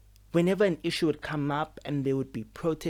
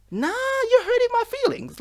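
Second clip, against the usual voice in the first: much higher, louder, put-on wounded voice; mock hurt whine; 5 dB louder than usual. Mocking how leaders hurt her feelings, in a high whiny voice. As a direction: squeal it like a hammed-up hurt child.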